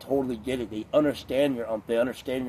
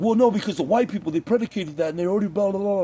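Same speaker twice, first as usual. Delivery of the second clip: mocking imitation; 7 semitones higher than usual. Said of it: "They're like".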